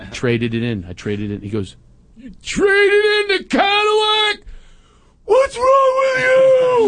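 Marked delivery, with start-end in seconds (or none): 2.2-6.9 put-on high, loud teenage wail of outrage; upset teen son. Son upset about the minivan, wailing in a high, loud voice. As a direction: shriek it like a teen betrayed. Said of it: "He starts crying".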